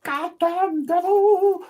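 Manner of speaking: screamed very high, slow metal wail; [name] Halford impression. [name] singing the song, high slow metal scream. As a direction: belt it like a metal god. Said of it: "[name]'s going".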